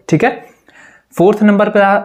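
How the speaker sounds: ordinary talk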